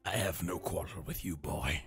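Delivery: deep menacing voice; very low gruff voice. Pretend. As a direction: speak low and heavy. Low alien voice.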